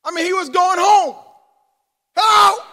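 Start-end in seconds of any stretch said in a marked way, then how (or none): 2.2-2.6 loud, high pestering hello; pestering widow's raised call. Acted. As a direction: call out to get his attention. Widow pestering the judge, acted.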